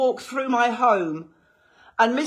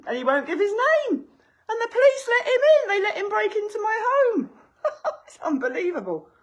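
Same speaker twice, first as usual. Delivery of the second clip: laughing in disbelief; 9 semitones higher than usual. Real, not acted.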